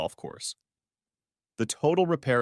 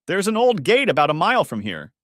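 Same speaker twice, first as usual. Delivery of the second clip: higher, louder urgent call; 7 semitones higher, 7 dB louder than usual. Acted. Lourdes directs Kevin to the old gate, loud and urgent. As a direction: point the way out over the noise.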